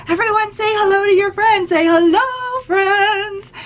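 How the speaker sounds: put-on rally the kids to sing along; cheerful teacher prompt. Shown as a demonstration. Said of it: "I always say to the kids".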